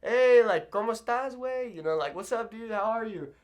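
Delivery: high, casual bro greeting; casual buddy greeting. Retold or imitated. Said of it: "kinda silly".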